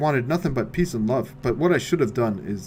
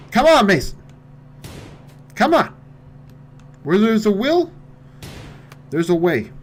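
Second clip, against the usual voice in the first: high, loud pleading encouragement; urgent cheering; 10 semitones higher, 8 dB louder than usual. Real, not acted. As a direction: urge your man on. Cheering on under pressure.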